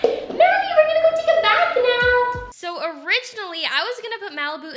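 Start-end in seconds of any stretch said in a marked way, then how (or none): 0.0-2.5 high sing-song call. Real, not acted. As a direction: call her over brightly. Calling out to dog.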